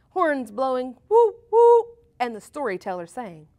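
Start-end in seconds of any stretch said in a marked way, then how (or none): 1.1-2.0 imitated horn blasts, high and loud; high loud horn toots. A narrator's reading. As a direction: toot it like a party horn.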